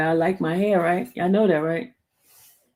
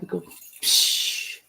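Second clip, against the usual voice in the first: spray sound; 3 semitones lower, 3 dB quieter, slower than usual. Shown as a demonstration.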